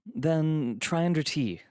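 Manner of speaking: high, slightly embarrassed suggestion; hint of shame. A narrator's reading. Guest admits another listing to valet, slightly ashamed. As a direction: offer it with a touch of shame. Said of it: "I said quickly, with a hint of shame".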